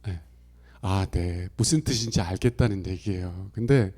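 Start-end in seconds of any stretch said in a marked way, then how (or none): 0.8-1.5 low, noncommittal Chungcheong "아, 네"; mumbled Chungcheong yes that means nothing; Chungcheong reply. Retold or imitated. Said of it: "충청도는 세 가지 답이 있어요".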